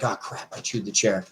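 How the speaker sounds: quiet mock apology from the dog; sorry dog voice. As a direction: voice a guilty dog apologizing softly.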